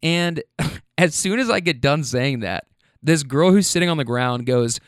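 ordinary talk

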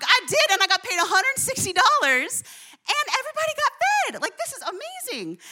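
high, loud, giddy retelling that peaks very high; thrilled bragging. Retold or imitated. Telling her brother about her payday, gleeful and high. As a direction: gush to your brother like it's the best day ever.

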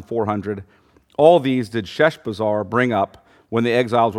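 scripture reading. Acted. Teacher reading the Ezra passage to the class, measured and steady.

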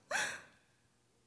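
sharp, very high gasp. A narrator's reading. Dorothy gasps as Harry embraces her, high gasp. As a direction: suck in air in surprise.

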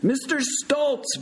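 slow, high holler of a name; shout. A narrator's reading. Big Bird to patient under sheet, shouting. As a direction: bellow the name at a hidden patient. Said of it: "Big Bird shouted again".

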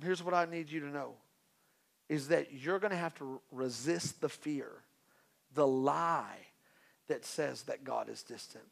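ordinary delivery

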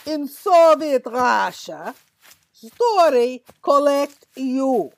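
put-on slow, heavy Russian-accented delivery; mock Russian accent. Acquisitor needling a partner with a Soviet Russia joke, mock Russian accent.

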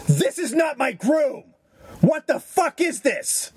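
high, slow, outraged shriek; mock the producer's indignant outburst. Producer pointing at the actor's face, high and outraged. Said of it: "she goes".

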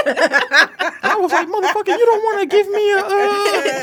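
high laughing protest; laughing, high-pitched retelling. Retold or imitated. Laughing about a cousin pushing a burger in his face, high. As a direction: squeal it out through laughter.

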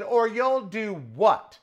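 very slow, high daring challenge; slow dare. Retold or imitated. Repeating the dare to the guard, slow and taunting. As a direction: stretch it out, taunting. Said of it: "I said".

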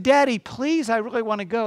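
high-pitched imitated begging; pleading daughter. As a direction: plead with dad like an eager daughter. Daughter begs her father in retelling.

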